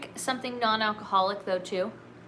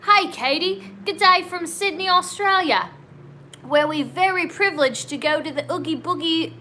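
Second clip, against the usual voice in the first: high, lively attempted Australian accent; higher-pitched amateur Australian lilt; 5 semitones higher than usual. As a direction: read it out in a put-on Aussie accent. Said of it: "read this in an Australian accent".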